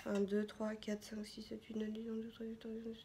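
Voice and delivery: very soft, rapid murmured count; counting under breath. Real, not acted. Vlogger tallying the tea bags to herself, counting under breath. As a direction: count quietly to yourself, barely audible.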